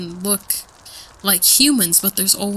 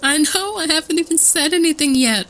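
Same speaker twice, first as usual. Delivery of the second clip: spooked giggly; 8 semitones higher, 4 dB louder than usual. Real, not acted. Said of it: "I'm getting a little spooked".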